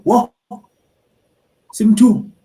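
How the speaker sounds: high, loud shocked "Whoa, Sim two"; shocked gasp. Retold or imitated. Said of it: "and they go".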